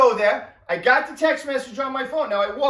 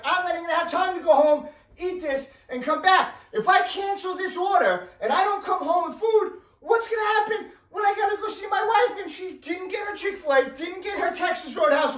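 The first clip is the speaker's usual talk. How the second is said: higher, rushing panicked rant; frantic rant; 6 semitones higher than usual. Retold or imitated. Narrator panics about facing his wife, higher and rushed. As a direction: spiral into panic, faster.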